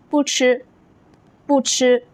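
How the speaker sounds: 不吃 with 不 not rising; original tone on 不. Shown as a demonstration. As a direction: say 不吃 without lifting 不 into second tone. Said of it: "不 keeps its original first tone".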